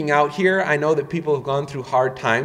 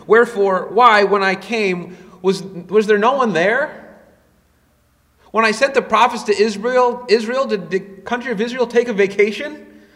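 raised pitch, incredulous mock questions; sarcastic questioning; 7 semitones higher, 4 dB louder than usual. God questioning absent Israel, sarcastic. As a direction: ask it loudly, as if demanding an answer. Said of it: "use of sarcastic questions here".

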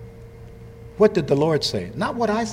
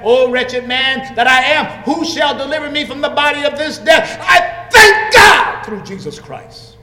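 anguished preaching shout; 9 semitones higher, 7 dB louder than usual. Acted. Preacher crying Paul's words out to the saints, full shout. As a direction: cry it out like Paul in agony and triumph.

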